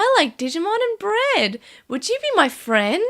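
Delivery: put-on shy little kid asking for friends; sweet Takado. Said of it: "Takado's just like".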